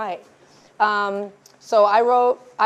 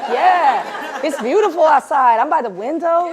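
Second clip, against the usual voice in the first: imitating a friend's higher, lilting delight; mildly gushing, pitched up; hyped; 4 semitones higher, 4 dB louder, slower than usual. Friend loving writing at the coffee shop, hyped. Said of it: "You're hyped to be writing".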